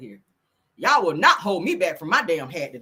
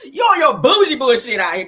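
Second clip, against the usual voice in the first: louder mock-outraged call-out; teasing shout; 3 semitones higher, 9 dB louder than usual. Real, not acted. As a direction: yell it half laughing. Teasing shout at rich influencer.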